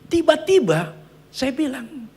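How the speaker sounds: ordinary talk, nothing marked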